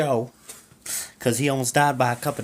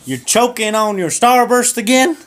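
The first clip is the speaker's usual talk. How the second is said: much higher, louder mocking wife; shrill wife voice; 9 semitones higher, 6 dB louder than usual. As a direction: sneer at him like the nagging wife.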